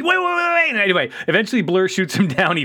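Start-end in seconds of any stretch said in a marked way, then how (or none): 0.0-0.7 panicked. Retold or imitated. Panicked retold plea.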